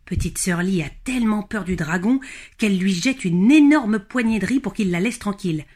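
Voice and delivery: usual speaking voice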